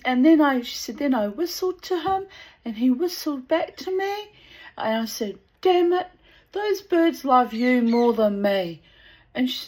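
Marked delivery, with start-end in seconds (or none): 0.0-0.6 imitated excited friend, higher and louder; eager, raised-voice quote; excited and happy. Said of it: "she was all excited and happy". 1.0-4.3 high-pitched imitation of an excited friend; excited, lifted quote; excited and happy. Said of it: "she was all excited and happy". 5.6-8.8 loud, high retold complaint; hammed-up put-out quote; mock indignant. Acts out her jealous complaint.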